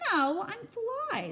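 high, slow squeaky fly; tiny fly voice. Acted. Fly answering Max, high and squeaky. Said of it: "says Fly".

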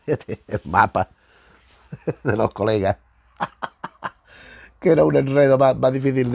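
amused, laughter breaking into the phrase; laughing while talking. Real, not acted. Reviewer telling viewers about the colleagues' map, chuckling. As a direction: chuckle through the words.

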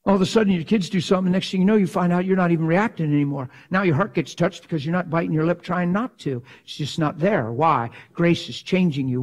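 ordinary talk

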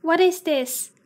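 ordinary talk, nothing marked

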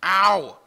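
loud pained yelp; sharp yelp of pain. Real, not acted. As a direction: cry out as you get hit. Hit by enemy in game.

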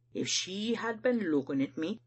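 ordinary delivery

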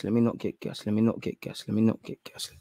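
soft, low repeated mutter; self-restraining mutter. Real, not acted. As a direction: quietly talk yourself down. Talking self down from hope.

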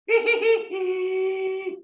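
high giggling hee hee; high alto giggle. Shown as a demonstration. Elementary school laughter, in alto. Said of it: "One last time".

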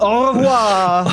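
slow, drawn-out French goodbye; French farewell. Acted. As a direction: stretch it out like a hammy Frenchman.

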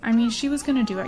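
usual speaking voice

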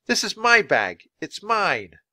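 high, loud protest; shouting. Acted. Cornered boy claiming his bag, shouting high. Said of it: "he shouted".

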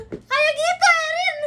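very high, loud teasing squeal; excited squeal. Real, not acted. Friend squealing at Erin about her animal sound.

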